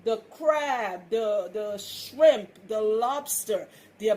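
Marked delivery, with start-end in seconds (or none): none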